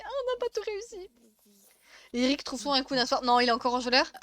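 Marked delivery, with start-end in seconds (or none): 0.0-1.1 panicked. Retold or imitated. Acts out end-of-life panic.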